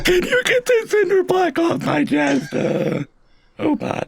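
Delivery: very high, slow, strangled squeak; a crushed chipmunk gasping for air; squeaky strained chipmunk voice. Pretend. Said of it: "chipmunk with a cinder block on its chest".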